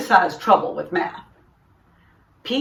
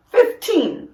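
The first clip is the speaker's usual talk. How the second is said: eager answer; 11 semitones higher, 4 dB louder than usual. A narrator's reading. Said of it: "says Tom".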